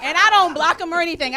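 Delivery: ordinary talk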